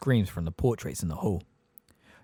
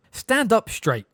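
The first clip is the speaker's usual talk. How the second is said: a much higher, louder impatient command; impatiently; 12 semitones higher, 6 dB louder, slower than usual. Acted. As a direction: bark an order at the groveling elf. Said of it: "said Sirius impatiently".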